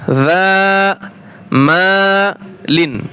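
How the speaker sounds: "যা Mullin" split into separate syllables with clear pauses; broken into parts. Shown as a demonstration. Teacher breaking a word up for learners, "যা Mullin" in separated syllables. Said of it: "আমি ভেঙ্গে ভেঙ্গে বলছি".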